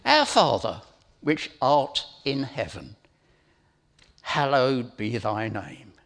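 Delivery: slow, each phrase weighed with pauses; slow deliberate reading. Shown as a demonstration. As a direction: read it slowly, meaning every word. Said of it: "let's have a look at this".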